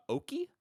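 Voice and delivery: Oki read like English "oakey", high and puzzled; mock misreading. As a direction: imitate someone reading Oki as an English word. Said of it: "you will look at the last name and see".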